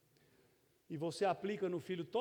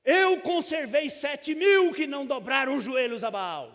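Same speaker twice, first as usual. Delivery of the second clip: loud, higher and hard, like God scolding; harsh rebuking tone; 7 semitones higher, 14 dB louder than usual. Shown as a demonstration. Pastor reads God's words to Elijah as a rebuke, harsh and loud. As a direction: read it as a stern rebuke, much louder. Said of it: "Por exemplo, eu podia ler esse texto aqui".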